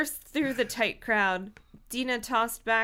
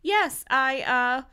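choked off; 4 semitones higher, 6 dB louder, slower than usual. Acted. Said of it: "her throat tightened, choking off her words".

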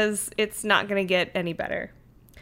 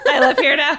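laughing; 6 semitones higher, 3 dB louder than usual. Pretend. Playful mock argument.

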